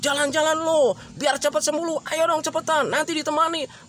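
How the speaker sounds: high-pitched, pushy command; insistent nagging to get moving; bossy ordering. Acted. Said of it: "yang sifatnya itu memerintah".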